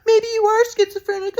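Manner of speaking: put-on very high, squeaky taunting girlfriend; shrill mock with a giggle at the end; mocking Katie.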